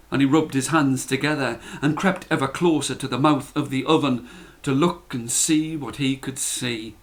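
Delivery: plain reading voice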